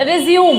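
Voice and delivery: रिज्यूम said as "ri-ZYOOM"; wrong take, रिज्यूम as two syllables, ri-zyoom; mispronunciation demo. Shown as a demonstration. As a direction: end रिज्यूम on a closed m. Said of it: "ये रिज्यूम नहीं होता".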